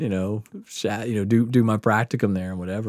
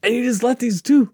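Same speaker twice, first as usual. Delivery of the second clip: imitating the doctor, much higher and louder, hammering it home; angry doctor ranting; 7 dB louder than usual. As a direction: scold the patient like an outraged doctor. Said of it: "And he's so angry".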